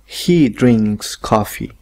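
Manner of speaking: slow and careful, every word clear; slow model sentence. Shown as a demonstration. Teacher modelling for Spanish-speaking students, slow. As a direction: say it slowly for the students to copy. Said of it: "¿Se fijan cómo".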